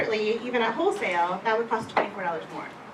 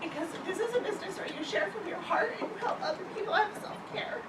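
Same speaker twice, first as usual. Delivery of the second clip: half-laughing, half-crying, voice breaking; laughing through tears; 6 semitones higher than usual. Real, not acted. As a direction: laugh it off while still teary.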